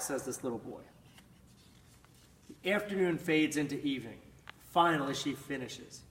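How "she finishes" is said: ordinary delivery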